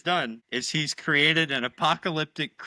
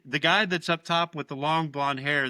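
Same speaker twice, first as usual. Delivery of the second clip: ordinary talk, nothing marked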